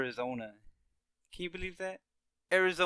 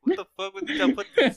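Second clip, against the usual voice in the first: much higher, louder shocked disbelief; shocked outburst; 8 dB louder than usual. Retold or imitated. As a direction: act caught off guard and outraged. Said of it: "He's gonna be like".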